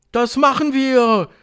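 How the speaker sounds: high, loud, drawn-out agreement; shouting crowd. A narrator's reading. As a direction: shout assent eagerly. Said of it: "schrien sie durcheinander". (schreiende Menge, hoch und gedehnt)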